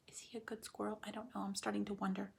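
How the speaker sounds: hushed aside to the class; whisper. Real, not acted. Teacher whispering her doubts about the squirrel to the class. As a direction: drop to a whisper, wondering aloud.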